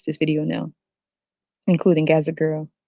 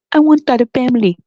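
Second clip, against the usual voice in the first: high, loud mock-eager voice; mocked eager woman; 7 semitones higher, 5 dB louder than usual. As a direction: imitate a gushing would-be mother. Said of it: "and say".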